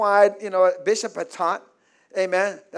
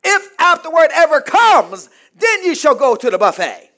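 loud, high pulpit cry, easing down at the end; emphatic shouted sermon; full-force preaching; 10 semitones higher, 13 dB louder than usual. Acted. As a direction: belt it out as the insistent master. Preaches as the demanding master.

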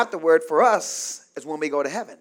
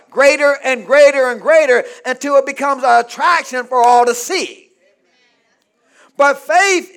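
loud call-and-response sermon, rising in pitch; rhythmic pulpit build; full-force preaching; 6 semitones higher, 11 dB louder than usual. Real, not acted. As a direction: drive each repetition home to the congregation.

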